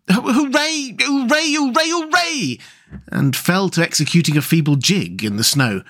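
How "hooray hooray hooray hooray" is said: very high, repeated cheers; jubilant cheering. A narrator's reading. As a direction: cheer over and over, pitched right up. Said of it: "Suddenly the rat cried".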